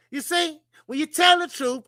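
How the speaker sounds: normal talking voice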